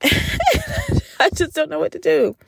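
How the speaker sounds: high, slow, laughing through the words; words squeezed out through laughter; helpless laughing. Real, not acted. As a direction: talk while cracking up. Laughs helplessly at own panic.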